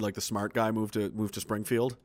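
ordinary talk, nothing marked

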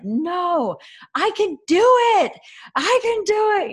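put-on high, loud child's "I can do it" whine; insistent toddler. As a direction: play a stubborn three-year-old refusing help. Mom voices her angry toddler.

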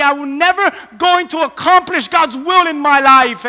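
shouted high, pleading at full force; desperate cry. Retold or imitated. A burdened believer crying out to God, loud and desperate. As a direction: cry out like someone bound and sick inside. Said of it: "and you're just saying".

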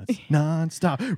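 ordinary talk

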